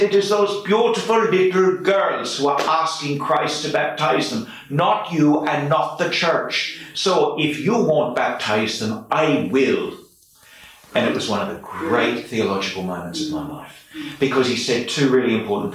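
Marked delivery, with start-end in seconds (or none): 0.0-9.9 impassioned Irish priest, pitched high then settling; Donal, Irish accent. Retold or imitated. Old priest defending the couple's babies to his deacon in an Irish accent. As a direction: lay down the law in an Irish accent. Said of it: "I'm sorry to do the accent".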